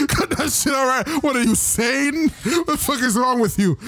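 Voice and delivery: high-pitched mock outrage; Harley scolding. Harley tells off a cured Joker, high indignant scolding. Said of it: "And then Harley's like".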